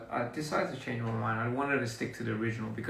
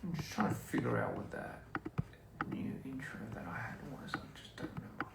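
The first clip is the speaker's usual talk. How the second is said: whispering; 11 dB quieter than usual. Real, not acted. Muttering to self, searching memory.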